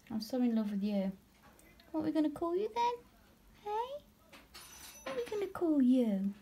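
doting high pet-voice. Real, not acted. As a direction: tease the spider fondly about its daddy. Cooing at spider about daddy.

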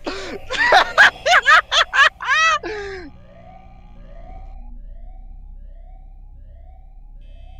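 high laugh. Real, not acted. Laughing after the scare.

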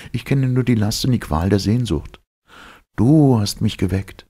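high, yearning heroine voice; aching with longing; longing young woman. Acted. Elita tells her dream. (sehnsüchtige, hohe Frauenstimme)

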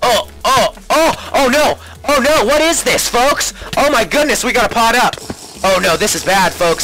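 high panicked yelps. Real, not acted. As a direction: sound alarmed, under attack. Panic when attacked in game.

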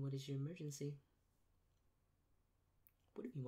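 ordinary talk, nothing marked

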